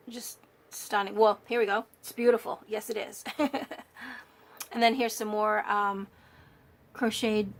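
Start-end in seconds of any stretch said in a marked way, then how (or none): 3.3-4.2 short, higher chuckle. Real, not acted. Crafter admiring her beautiful page, chuckling. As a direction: laugh lightly, pleased with it.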